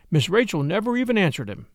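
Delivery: ordinary delivery, nothing marked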